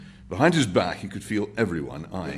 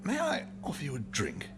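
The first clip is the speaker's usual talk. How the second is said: softer, higher polite offer; gentle offer; 10 semitones higher, 5 dB quieter than usual. A narrator's reading. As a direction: coax the old man kindly. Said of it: "caught him gently by the arm".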